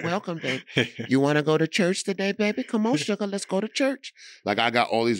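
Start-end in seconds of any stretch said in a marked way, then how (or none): 0.0-4.1 high-pitched church-going mama; old mama voice. Shown as a demonstration. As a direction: coax like an old mama calling her baby to church. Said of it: "I can do an old mama voice".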